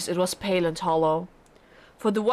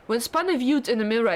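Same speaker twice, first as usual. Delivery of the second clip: ordinary delivery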